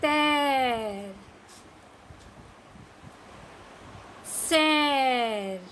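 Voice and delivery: put-on each word drawn out very slowly for children to repeat; slow model reading. Shown as a demonstration. Teacher modelling words slowly for kids to repeat. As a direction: stretch every word out slowly so the class can echo it. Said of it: "teacher के पीछे repeat करते जाइए".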